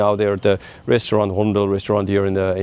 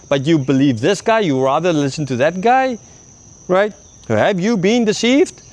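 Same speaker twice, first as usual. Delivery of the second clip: scolding Pharisee; 7 semitones higher, slower than usual. Retold or imitated. Pharisees scolding officers, acted.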